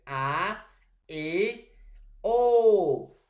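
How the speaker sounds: teacher sounding out the open vowels; open vowel demo. Shown as a demonstration. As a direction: open the mouth wide on each vowel, building. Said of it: "No necesita mucho esfuerzo".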